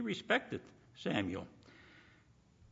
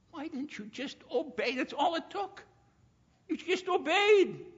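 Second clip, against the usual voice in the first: anguished plea; 7 semitones higher than usual. Retold or imitated. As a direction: plead out of love and grief. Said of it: "the love of Samuel for Saul".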